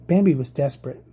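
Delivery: ordinary delivery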